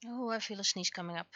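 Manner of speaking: normal talking voice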